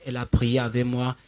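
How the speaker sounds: normal talking voice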